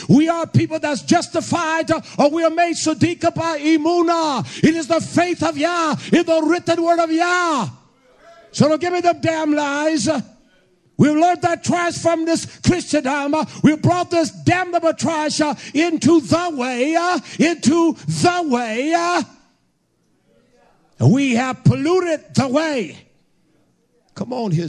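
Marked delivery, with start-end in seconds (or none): 0.1-16.9 high, driving sermon voice; fiery preaching. Acted. Preacher scolding the church over false teaching, fiery. 17.0-18.9 slow, drawn-out shout; slow preacher shout. Acted. Preacher hammering home the phrase, slow shout. 18.9-23.0 high, driving sermon voice; fiery preaching. Acted. Preacher scolding the church over false teaching, fiery.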